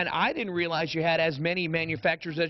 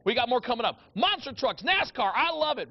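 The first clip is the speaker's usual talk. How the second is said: monster truck announcer voice; 7 semitones higher than usual. Acted. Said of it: "Let me hear your monster truck voice".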